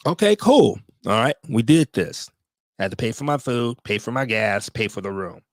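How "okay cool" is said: sarcastic okay. Real, not acted. Sarcastic resigned okay cool.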